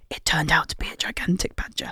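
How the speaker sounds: soft, whispered mock reveal; hushed whisper. Telling co-host of the gigantic badger, in a hushed whisper. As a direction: whisper it like an astonishing secret.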